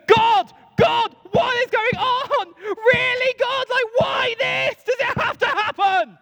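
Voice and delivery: very high, loud wail to God; drawn-out, hammed-up anguished shout; anguished cry. Jesus on the floor pleading with God, anguished.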